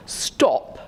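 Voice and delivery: posh "Stop" whispered with no voice; a whispered, upper-class order to halt. Retold or imitated. Education Secretary silencing the teacher, whispered posh voice. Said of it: "in his very posh voice".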